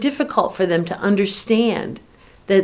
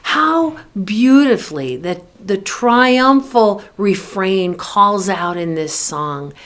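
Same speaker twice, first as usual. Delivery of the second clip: normal talking voice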